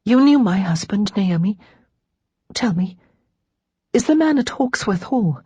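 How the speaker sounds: forced out in broken pieces; halting, beseeching question; halting words. A narrator's reading. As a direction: hesitate between phrases, pleading. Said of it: "forced out a few halting words".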